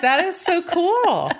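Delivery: high, slow and delighted; thrilled. Retold or imitated. Telling Pauline how cool the front page is, thrilled. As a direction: gush it like the best news ever.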